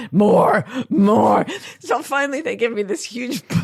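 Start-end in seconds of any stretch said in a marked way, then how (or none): 0.1-1.5 louder shouted "more"; loud demand. Retold or imitated. Traveller shouting at shop staff for more bubble wrap, loud demand. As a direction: shout for more like you mean it.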